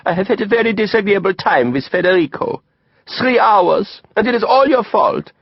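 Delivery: put-on high, accented sulky complaint; inconsolable pout. A narrator's reading. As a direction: whine the blame through a pout. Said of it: "pouting inconsolably".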